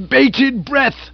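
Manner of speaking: aunt supplying word. Acted. Aunt supplying the phrase to Bertie. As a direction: snap in the missing words. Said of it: "said the ancestor".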